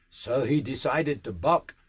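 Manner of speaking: ordinary delivery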